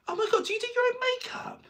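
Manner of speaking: high, loud gushing fan voice with laughter. Retold or imitated. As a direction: squeal it like a starstruck fan. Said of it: "things you should never say to a drag performer".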